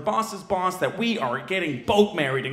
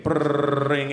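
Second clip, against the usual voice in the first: trilled low telephone ring; imitated phone ring; 5 semitones lower than usual. He waits as Nikita's phone rings, trilled ring. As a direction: roll it like a ringing phone.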